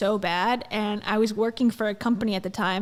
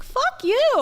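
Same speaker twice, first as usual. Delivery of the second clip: high, loud mock retort; telling the liar off, loud and high; mock snapping back; 5 dB louder than usual. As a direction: snap it back, pitched way up. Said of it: "I'm like".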